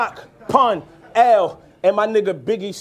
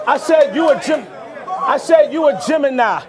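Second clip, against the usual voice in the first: raised, high call-back of the bar; emphatic restart; 5 semitones higher than usual. Acted. Rapper repeating a bar to the crowd, emphatic restart. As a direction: push it up over the crowd.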